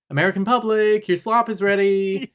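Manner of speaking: high, loud hawker-style call; mock announcement. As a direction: call the public to dinner, hammed-up. Mock executives calling out to viewers.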